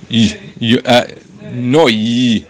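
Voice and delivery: yi stretched into a long "yee"; overlong final yud. Shown as a demonstration. Teacher overdoes the last letter for class, drawn-out yi. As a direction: hang on to yi far too long. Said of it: "I did it too long".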